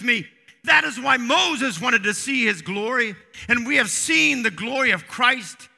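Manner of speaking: high, fervent pulpit delivery; impassioned preaching. Real, not acted. Preacher proclaiming Christ's presence to the congregation, impassioned. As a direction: lift the voice and proclaim it.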